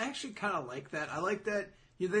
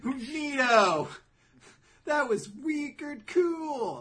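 higher, drawn-out goofy approval; mock-impressed; 9 semitones higher, 7 dB louder, much slower than usual. Viewer reacting to an odd ending, mock-impressed. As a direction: stretch the words in exaggerated wonder.

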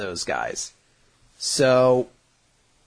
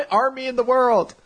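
ordinary talk, nothing marked

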